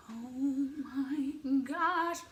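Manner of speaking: very slow, drawn-out mock awe; high, sing-song dazed fan; starstruck awe. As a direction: stretch every word, almost singing it. Retold starstruck moment, mind blank.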